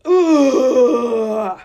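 loud anguished ugh, half a cry; a loud pained ugh of revulsion; disgusted groan. Real, not acted. Reacting to assault story.

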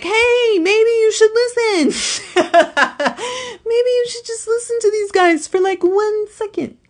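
high pleading inner voice. Retold or imitated. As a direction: plead like a nagging intuition. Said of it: "my intuition is like".

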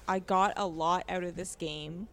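ordinary delivery

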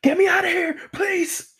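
very high, panicked begging; panicked frantic pleading. Pretend. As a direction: squeal for rescue in mock terror. Voicing a trapped robot.

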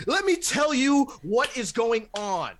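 booming sports talk show rant; Stephen A Smith. Retold or imitated. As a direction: hammer it like a hot-take TV pundit. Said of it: "like, a Stephen A Smith of".

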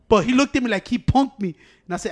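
ordinary talk

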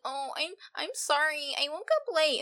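drawn-out mocking apology. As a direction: mimic a sheepish excuse. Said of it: "you're gonna be like".

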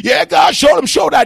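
high, loud exclamation; excited shout. Acted. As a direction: shout it up to the rafters. Preacher exclaiming.

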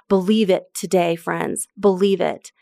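ordinary talk, nothing marked

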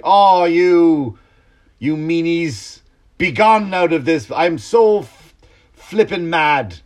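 high, loud, prim scolding with slow, mincing words; prissy mock Jesus. Mocking a mild-mannered Jesus at the temple, prim and prissy. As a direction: play a sissy Jesus scolding politely. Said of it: "Jesus wasn't going like".

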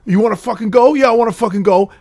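a hint of comedian's tough guy; New York tough guy. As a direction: square up like a New Yorker. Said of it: "He goes".

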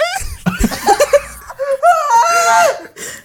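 high, loud burst of laughter; real shrieking laugh. Real, not acted. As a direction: crack up completely. Laughter after the squeak.

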